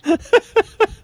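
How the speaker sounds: a high, loud squeal of laughter. Real, not acted. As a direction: burst into a squealing giggle. Laughing at own joke.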